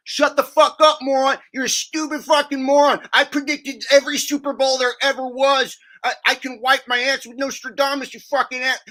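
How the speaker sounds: high, loud sneering rant; mocking angry troll. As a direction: shriek it like a raging know-it-all. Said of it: "he'll be like".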